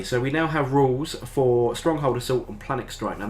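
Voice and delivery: ordinary delivery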